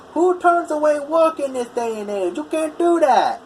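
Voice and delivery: high, indignant imitation of a Boston-like accent; thick Boston-like accent. Imitating customer turned away by welder, indignant thick Boston-like accent. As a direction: mimic an outraged customer in a thick northern, almost Boston accent. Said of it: "in the really thick northern, almost Boston-like accent".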